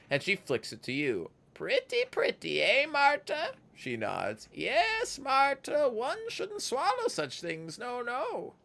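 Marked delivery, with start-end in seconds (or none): 1.5-3.5 gleeful, creepy crone voice; sing-song voice. Acted. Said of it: "in a strange sing-song voice". 4.5-8.5 very high, slow sing-song crone; gleeful, creepy crone voice. Acted. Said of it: "in a strange sing-song voice".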